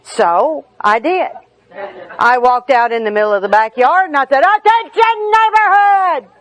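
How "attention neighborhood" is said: mock town crier shout; yelling to the neighborhood. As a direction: call it out across the backyard for all to hear. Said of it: "I'm yelling it out".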